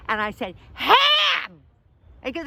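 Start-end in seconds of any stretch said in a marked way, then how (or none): 0.7-1.6 high, hammed-up holler; comic full-volume yell; exasperated shout. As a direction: bellow the order so it gets through. Shouting order through a mask.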